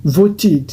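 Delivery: voted in two slow syllables, vo-tid; ED as extra syllable. Shown as a demonstration. Teacher showing T plus ED, voted said slowly as vo-tid. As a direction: say voted with a full id ending. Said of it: "ça fait voted".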